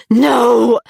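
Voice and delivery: sharp loud cry of protest; anguished no. A narrator's reading. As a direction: shout it out in despair. Heroine screams as lover dragged.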